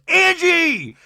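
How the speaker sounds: high, loud mock yell of a name; imitated sudden shout; exasperated yell. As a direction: holler it to silence someone. Mary finally silencing Angie, retold.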